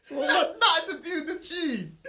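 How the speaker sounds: high, fast, laughing hard; words squeezed out while cracking up. Real, not acted. Laughing at friend's accent.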